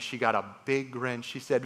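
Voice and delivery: usual speaking voice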